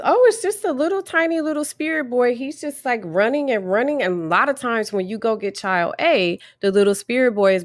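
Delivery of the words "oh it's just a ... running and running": higher, faster, breezy mother's voice; imitating the mother. Mother casually explaining the spirit boy to the therapist, breezy and high. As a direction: toss it off lightly like a casual mum. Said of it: "the mother goes".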